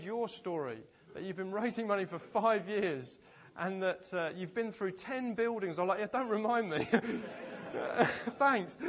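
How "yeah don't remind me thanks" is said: laughing it off, a bit louder; laughing, self-mocking groan. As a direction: brush off the praise with a laugh. Laughing retort to admiring pastor.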